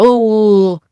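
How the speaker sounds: big open oh, jaw swinging like Cookie Monster; exaggerated oh, jaw wide. Shown as a demonstration. Therapist mocking a child's jaw-driven speech, big oh. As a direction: mouth the oh with a huge jaw drop. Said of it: "moving my jaw all over the mouth like Cookie Monster".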